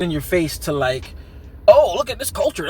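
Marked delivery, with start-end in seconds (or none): 1.7-2.7 mock showing-off. Mocks a heavy-handed film.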